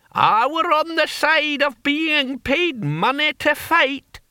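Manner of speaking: put-on very high, drawn-out old man's cry; deaf old barbarian. Deaf old Hamish answers.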